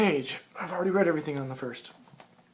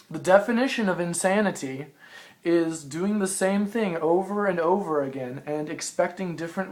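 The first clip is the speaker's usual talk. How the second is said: reading aloud; 3 semitones higher, 3 dB louder than usual. Acted. Reads own written passage aloud.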